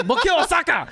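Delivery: high-pitched mock insistence; exasperated driver, imitated. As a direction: act out the driver insisting, voice jumping up. Acted back-and-forth argument with driver.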